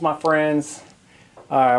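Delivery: normal talking voice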